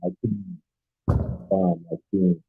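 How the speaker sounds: very faint, far from the phone; barely audible. Real, not acted. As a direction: caller too quiet to hear. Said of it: "Your voice is very low".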